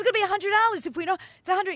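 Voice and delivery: high, loud, fast frantic fretting; going crazy. Retold or imitated. Daughter fretting at boyfriend over the money, frantic. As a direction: rattle it out in a mock panic. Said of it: "she's going all crazy".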